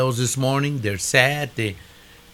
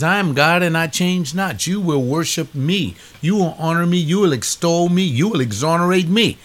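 God commanding; 5 semitones higher than usual. Retold or imitated. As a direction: thunder out God's demands. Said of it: "God says".

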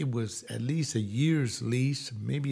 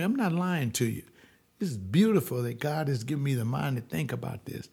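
usual speaking voice

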